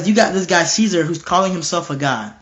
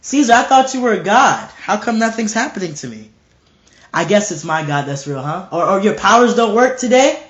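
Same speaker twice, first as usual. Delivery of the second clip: raised taunting questions; mocking; 4 semitones higher, 3 dB louder than usual. Unburned martyr jeering at Caesar, mocking. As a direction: goad with high, pointed challenges. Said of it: "I would do it too".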